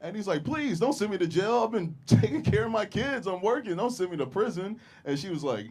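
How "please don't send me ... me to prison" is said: high, louder whiny begging; mock pleading. Defendant begs judge for mercy, whiny pleading. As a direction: beg the judge, pitched up.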